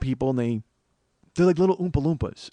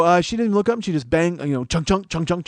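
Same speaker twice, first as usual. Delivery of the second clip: usual speaking voice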